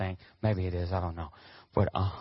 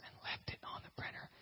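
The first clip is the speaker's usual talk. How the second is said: hushed, whispered secret; confidential whisper; 20 dB quieter than usual. Acted. Pastor letting out his boss's secret, confidential whisper. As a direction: whisper it like office gossip.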